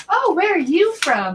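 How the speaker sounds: high, loud, swooping question; exaggerated high-pitched surprise; mock curiosity. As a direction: imitate a nosy coworker, loud and high. Voicing an inquisitive coworker.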